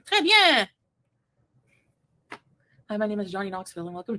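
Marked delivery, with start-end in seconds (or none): 0.0-0.7 hammy French praise. Pretend. Showing off French phrases.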